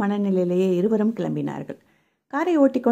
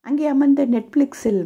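plain reading voice

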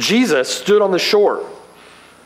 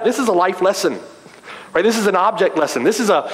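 ordinary talk

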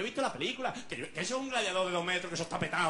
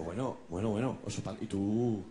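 much lower, calm reply; deep voice; 10 semitones lower than usual. Acted. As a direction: answer the panda in a deep manly voice. (voz grave y tranquila)

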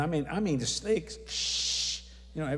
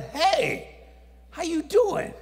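high, loud hail; loud startled greeting; 13 semitones higher, 7 dB louder, much slower than usual. Retold or imitated. Greeting the angel in the ski car, high loud holler. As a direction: call out a high-pitched hello.

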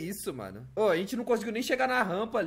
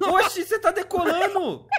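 excited surprise; 8 semitones higher, 3 dB louder than usual. Real, not acted. Friend's car flies off ramp.

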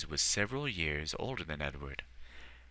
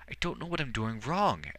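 put-on higher frustrated boy's cry; frustrated outcry; 7 semitones higher, 3 dB louder than usual. Boy failing to heal the rat, frustrated cry. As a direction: let the frustration burst out. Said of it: "cried out in frustration".

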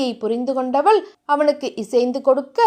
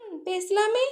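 soft, higher, playful lilt; coy agreement; 6 semitones higher, 9 dB quieter, slower than usual. Acted. Heroine gives in to her teasing lover, coy. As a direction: agree softly and coyly, lifting the pitch.

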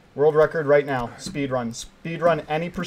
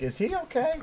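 high, soft concerned voice; small high-pitched imitation of viewers; worried viewers; 13 semitones higher, 6 dB quieter than usual. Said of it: "maybe you guys are like".

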